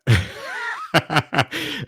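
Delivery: high burst of laughter; real high-pitched laugh. Real, not acted. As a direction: laugh at your own showy intro. Laughs after his own intro.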